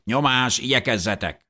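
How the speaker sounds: high-pitched soldier hurrying people along; screeched order. A narrator's reading. Soldier drives the family out, screeching. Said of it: "rikácsolta az egyikük".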